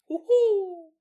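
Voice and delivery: high eerie cackling hoot; goblin laugh. Retold or imitated. Goblin laughs from a dark hallway, high and eerie. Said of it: "the sound of a goblin".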